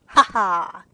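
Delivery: loud stagey "Ha ha" of a dying villain; wild villain laugh. Acted. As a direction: cackle it like a melodrama villain. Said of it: "with a wild".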